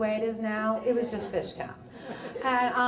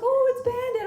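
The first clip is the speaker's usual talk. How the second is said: delighted cooing; 14 semitones higher, 6 dB louder than usual. Retold or imitated. Greeting dog she thinks is Bandit.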